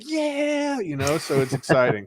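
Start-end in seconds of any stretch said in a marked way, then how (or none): 0.0-0.8 high cheering whoop; cheering yeah. Retold or imitated. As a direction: whoop along with the studio. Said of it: "And everybody's like".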